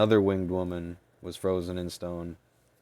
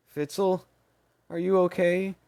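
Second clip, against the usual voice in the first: worried; 12 semitones higher, 5 dB louder than usual. Acted. Maney anxiously checks on fairy.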